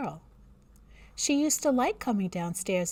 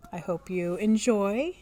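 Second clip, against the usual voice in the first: usual speaking voice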